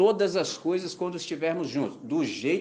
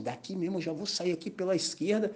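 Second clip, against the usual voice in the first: inner thought; 2 semitones higher, 7 dB quieter than usual. Retold or imitated. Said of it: "Eu falei assim".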